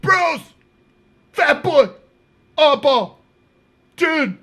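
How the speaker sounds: high mock call to the others. Acted inmate calling out to others.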